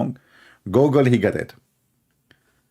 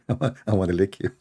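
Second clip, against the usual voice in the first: repeated fast through laughter; giggling out the line quickly; amused laughing repeat; 3 semitones higher, much faster than usual. Real, not acted. Repeating the funny mishearing.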